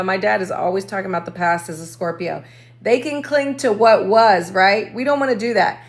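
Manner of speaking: usual speaking voice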